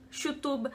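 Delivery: "YouTube" com Y chiado, mais baixinho; chiado argentino. Shown as a demonstration. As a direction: diga "YouTube" como "chutube", em voz baixa. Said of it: "eles falam muito chiado". ("YouTube" chiado, à argentina)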